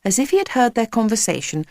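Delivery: ordinary delivery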